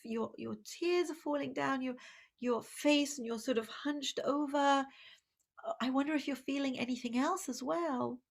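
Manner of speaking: a parent softly describing the tears; a tender, quiet parent; gentle parent. Acted. Parent validating a crying child.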